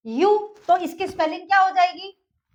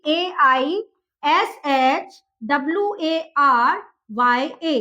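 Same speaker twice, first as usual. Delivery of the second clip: slow spelling; 3 semitones lower, much slower than usual. Shown as a demonstration. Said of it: "इसकी spelling हो जाएगी".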